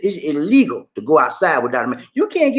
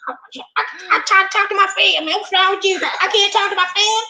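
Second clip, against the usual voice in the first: far higher put-on voice, fast; squeaky mock voice. Teasing his wife on stream, high squeaky mock voice. Mocking wife's complaint in high voice.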